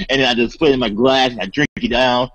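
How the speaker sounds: ordinary delivery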